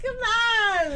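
whiny high mock cry. Host mock-whining about the studio, high crying. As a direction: wail like a short-armed kid. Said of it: "I'm like".

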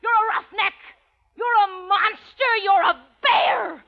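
high, heated name-calling; furious insults. Acted. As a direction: hurl each insult at him.

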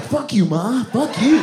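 put-on high, cocky little kid shouting back; bratty kid yell. Little kid cursing back at his mom, bratty and high. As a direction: sass your mom like a spoiled brat. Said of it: "the little kid's like".

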